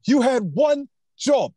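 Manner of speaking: high, put-on British movie quote; emphatic British accent, each word punched out. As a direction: do an exasperated Brit, pitched well up and drawn out. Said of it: "do the British accent".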